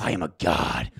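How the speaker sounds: low, grand mock director voice; Kubrick impression. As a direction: boom it out like a self-declared god. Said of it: "That was a great impression".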